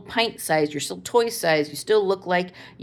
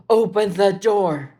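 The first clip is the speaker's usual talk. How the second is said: put-on slow emphatic kid voice; 3 semitones higher, 6 dB louder, slower than usual. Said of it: "he suddenly says".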